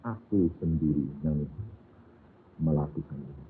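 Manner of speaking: a hint of a gentle Lord speaking low; Jesus' voice, gently. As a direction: answer her tenderly and quietly. Jesus answers the woman.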